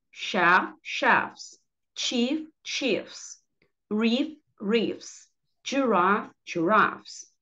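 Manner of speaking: normal talking voice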